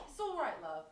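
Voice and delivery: put-on loud, high British-accented consolation; hammed-up British comforting, called out brightly; imitated British lady. British woman comforting crying stranger on tube, imitated accent. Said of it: "this reserved British woman".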